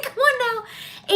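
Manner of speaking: slow, high Southern drawl on Come on now; teasing Southern accent, high and drawn out. Shown as a demonstration. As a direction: lean into a playful Southern accent. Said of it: "Nothing wrong with a Southern accent".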